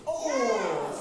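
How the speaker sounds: loud, drawn-out "ohh" of a near miss; disappointed "oh". Real, not acted. Children's talk leader watching a banner just miss, loud groaning "oh". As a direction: let out a long, loud, falling "oh".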